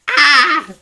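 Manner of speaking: sudden high loud yelp of fright; scared scream. Retold or imitated. Battle mage spots spiders, frightened high yelp. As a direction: shriek like a spider just appeared. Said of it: "he gets scared".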